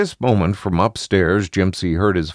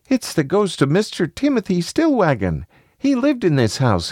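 put-on high-pitched small boy; high child voice; little child; 11 semitones higher than usual. A narrator's reading. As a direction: answer like an uneasy young child. Voices Jimsy telling his story.

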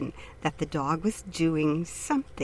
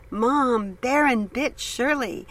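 higher, calling out the news; loud announcement; 6 semitones higher, slower than usual. A narrator's reading. Daughter calls loudly to her mother about the bite. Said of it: "Anne loudly announced".

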